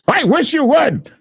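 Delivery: high, put-on cocky dare; daring taunt. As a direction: throw it out like a challenge. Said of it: "I don't even know why I'm saying that".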